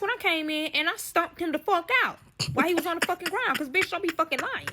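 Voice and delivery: high and loud, fired up; heated outburst. Real, not acted. Recounting stomping the lying friend, high heated outburst.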